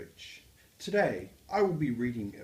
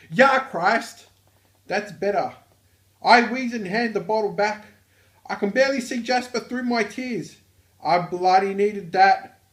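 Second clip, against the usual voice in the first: loud, high-pitched Australian; Australian accent; 10 semitones higher, 14 dB louder than usual. Acted. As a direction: belt it out in a broad, hammed-up Aussie accent. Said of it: "proper use of an Australian accent".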